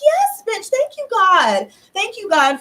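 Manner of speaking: high-pitched burst of jubilant gratitude; exultant thanks. Retold or imitated. Grateful woman thanking God after a good day, exultant. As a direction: cry it out high, overjoyed.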